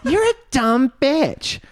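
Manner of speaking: put-on high, slow, drawn-out drunk jeer; mock drunken insult. Drunk girl calling the other a dumb bitch, high slow jeer. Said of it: "And she's like".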